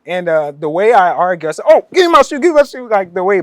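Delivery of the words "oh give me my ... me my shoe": much higher, loud Pidgin plea; Pidgin English. Retold or imitated. Haggling with a big seller over his shoe, loud high Pidgin. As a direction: argue for your shoe in Ghanaian Pidgin, pitched way up. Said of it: "I said it in Pidgin".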